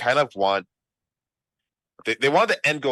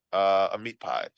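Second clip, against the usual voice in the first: ordinary delivery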